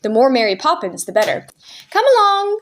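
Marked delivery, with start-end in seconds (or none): 1.9-2.6 brisk stern call, pitched up; stern command. A narrator's reading. Nanny hurrying the children, mild sternness. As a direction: snap it out like a strict nanny. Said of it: "she said sternly".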